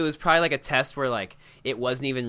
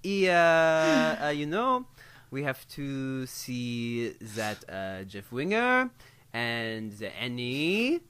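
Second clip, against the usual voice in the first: put-on English in a playful French accent, higher and halting; 5 semitones higher, slower than usual. Cohost asked to show her French-accented English, playful French accent. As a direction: hesitate and drawl like a French speaker in English. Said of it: "Can we hear a little bit of it?".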